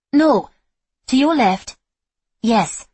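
sharp loud coaching calls; shouted directions. Acted. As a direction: bark orders mid-sparring. Coach barking sparring directions.

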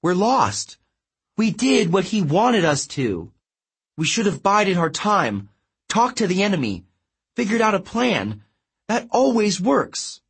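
high-pitched, agitated protest; distressed demanding. A narrator's reading. Girl lost in darkness blaming her friend, distressed. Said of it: "Annabeth demanded".

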